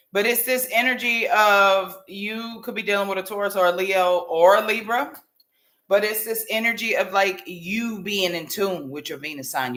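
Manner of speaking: ordinary talk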